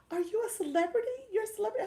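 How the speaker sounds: high, lightly voiced questioning; mock curious stranger. Quoting the stranger who asked if she's famous, high mock voice. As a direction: play the impressed stranger, pitch up. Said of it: "and the person said".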